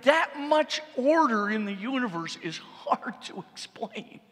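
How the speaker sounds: dramatic preacher emphasis. Acted. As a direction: preach it, leaning hard on each word. Dramatic restatement continues.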